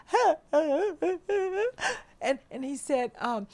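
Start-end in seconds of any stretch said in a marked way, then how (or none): 0.1-2.0 high, breathless sobbing huhs; choked-up sobbing breaths; overwhelmed sobbing gasps. Retold or imitated. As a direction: gasp out little sobs, too overcome to speak. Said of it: "And I just went".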